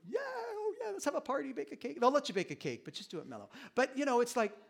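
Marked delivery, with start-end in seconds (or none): 0.1-2.0 much higher, gushing mock delight; a mom over-celebrating her son's news; mock excited mom. Mom mockingly gushing over her son's good news. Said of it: "especially you moms, you wanna go".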